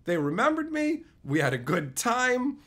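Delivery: usual speaking voice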